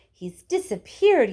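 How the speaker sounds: loud, slow yell; mother yelling in alarm. Acted. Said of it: "yelled Missus Gloop".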